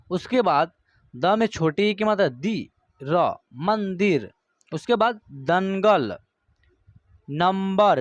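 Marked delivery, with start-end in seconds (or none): 3.0-4.3 मंदिर said very slowly and clearly; teacher modelling मंदिर, very slow; slow demo word. Shown as a demonstration. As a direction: draw out मंदिर syllable by syllable. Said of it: "देखिए".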